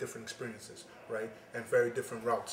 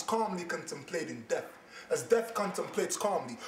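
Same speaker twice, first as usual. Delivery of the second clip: impassioned slam, building in force; spoken word poem; 6 semitones higher than usual. Acted. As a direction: start measured, then drive the rhythm harder and harder.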